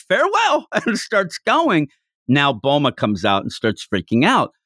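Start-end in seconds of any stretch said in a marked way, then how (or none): 0.1-0.7 high-pitched, theatrical call; mock farewell yell. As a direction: call it out high, imitating a fleeing villain. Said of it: "Oolong yells".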